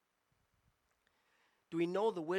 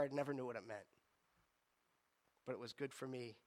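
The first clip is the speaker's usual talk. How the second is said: ordinary talk, nothing marked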